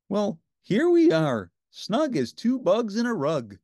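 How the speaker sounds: high, slow puppet voice, snug and content. A narrator's reading. Ernie settling into the tent with Bert, slow and high.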